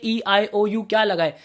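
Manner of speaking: ordinary talk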